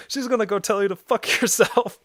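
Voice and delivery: punchline breaking into laughter; laughing. Real, not acted. Punchline about grandma, laughing.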